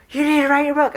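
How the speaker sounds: high, loud, fed-up demand; exasperated yell. Acted. Universe tired of whispering to the writer, yelling. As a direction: yell it like patience has run out. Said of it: "it was tired of whispering".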